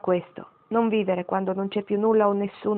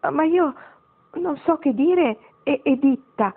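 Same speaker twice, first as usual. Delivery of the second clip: higher, slow, timid stammer; fearful stammer; 7 semitones higher, 3 dB louder, slower than usual. A narrator's reading. Marigold nervously tries to say grandmother's name, stammering. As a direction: stumble over the name in awe. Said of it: "balbettò Marigold, e c'era del timore".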